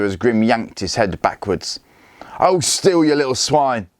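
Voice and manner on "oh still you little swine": snarled. A narrator's reading. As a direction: spit it at him. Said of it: "Grim snarled".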